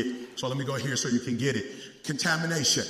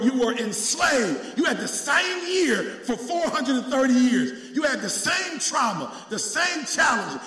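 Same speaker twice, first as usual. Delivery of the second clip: loud, high preaching shout; God's voice preached at full force; 11 semitones higher, 6 dB louder than usual. Acted. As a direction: thunder God's words at the congregation. Preacher voicing God at full force.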